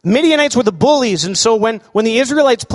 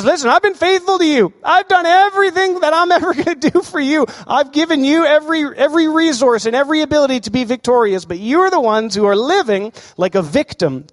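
higher, firm divine voice addressing his people; God speaking; 7 semitones higher than usual. Acted. God to hiding Israel, higher and firm, with a laugh. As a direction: speak as God reminding them he's been faithful.

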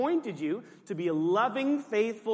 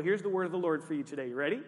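usual speaking voice

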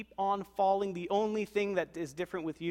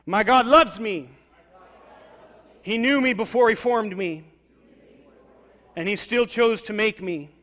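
much louder, higher, emphatic; declared with conviction; 3 semitones higher, 10 dB louder than usual. Shown as a demonstration. Congregation repeating after pastor, loud and sure. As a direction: proclaim it like you mean it. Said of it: "repeat it like you mean it".

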